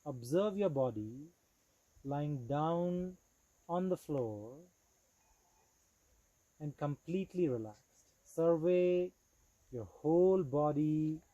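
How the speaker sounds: measured, deliberate yoga nidra guidance with long pauses; steady, clearly projected meditation instructor; guided meditation. Acted. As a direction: guide them into relaxed awareness, phrase by phrase.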